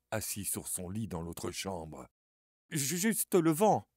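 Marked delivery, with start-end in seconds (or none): 2.7-3.9 slow, stammering, shaky reassurance; trembling voice. Acted. Charlie lies to his sister about the knocking, trembling. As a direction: try to hide the fear, stumbling. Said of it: "dit Charlie de sa voix tremblante".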